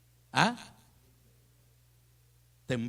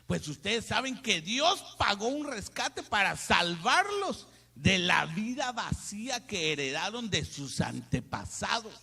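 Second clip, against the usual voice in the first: slow, higher, solemn reading; scripture reading; 4 semitones higher, 5 dB louder, slower than usual. Acted. Preacher reading First Peter aloud, slow solemn reading. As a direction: read each word slowly to the church.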